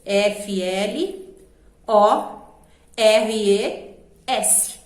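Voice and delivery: "F L", pause, "O", pause, "R E", then "S"; letters grouped wrongly, pauses between the groups; wrong two-letter split. Shown as a demonstration. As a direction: spell "F L O R E S" in broken chunks. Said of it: "separa a sílaba como?".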